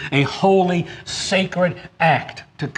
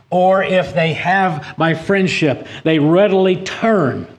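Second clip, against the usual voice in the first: higher and louder sermon rebuke, slowing on key words; preaching at full force; 6 semitones higher, 4 dB louder than usual. Acted. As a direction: thunder a warning at the brethren.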